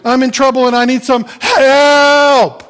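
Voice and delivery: high, loud plea, wailing at the end; hammed-up holler of someone in trouble; cry for help. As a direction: call out desperately, stretching the last word.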